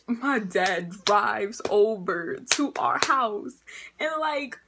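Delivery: ordinary delivery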